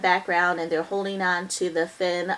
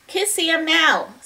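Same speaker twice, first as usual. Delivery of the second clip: a hint of a little sister; 8 semitones higher, 4 dB louder, slower than usual. Annie urging Jack.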